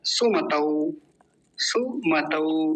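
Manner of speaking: सुमतौ ending in an open "au" diphthong; au vowel. Shown as a demonstration. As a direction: say सुमतौ as "su-ma-tau". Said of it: "वो है औकार की मात्रा".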